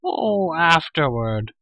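high creature wail of dismay; crying-out Gurgi. A narrator's reading. Hungry creature told to wait for food, crying out. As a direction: cry it out, starting high and falling. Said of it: "cried Gurgi".

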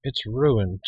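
plain reading voice

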